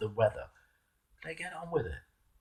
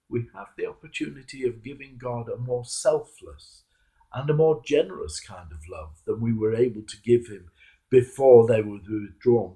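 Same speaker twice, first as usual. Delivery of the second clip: ordinary talk, nothing marked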